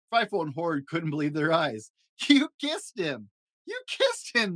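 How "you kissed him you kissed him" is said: very high, delighted laughing cry; laughing disbelief. A narrator's reading. Siblings laughing at Little Shock's kiss. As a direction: crow in amazed glee. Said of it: "they laughed".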